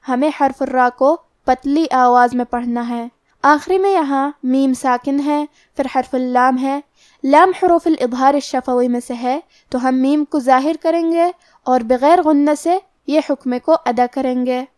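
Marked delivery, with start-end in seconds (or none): none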